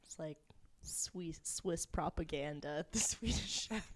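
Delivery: soft, breathy aside; hushed aside; whisper. Real, not acted. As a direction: whisper it under your breath. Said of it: "whisper heard".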